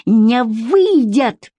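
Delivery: very slow, word-by-word refusal; emphatically enunciated. A narrator's reading. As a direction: stretch each word defiantly. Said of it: "и отчеканила".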